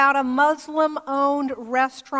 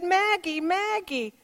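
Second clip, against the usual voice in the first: higher, calling her name twice; 5 semitones higher than usual. Retold or imitated. Homeless man calling to the pastor, higher call. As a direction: hail her like a man spotting a friend.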